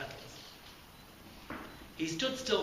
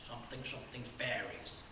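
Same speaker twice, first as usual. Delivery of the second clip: mumbling; 3 semitones lower, 10 dB quieter than usual. Acted. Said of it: "The ghost mumbled again".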